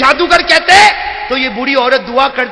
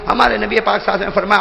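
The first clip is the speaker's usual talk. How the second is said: usual speaking voice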